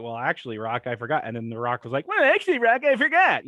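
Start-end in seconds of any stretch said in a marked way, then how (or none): 2.1-3.5 very high, loud whiny mock voice; impression of the Coach. Rock mocking the Coach's excuse, very high whiny impression. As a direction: whine it up high like a nerdy sidekick. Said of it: "how he used to do that impression".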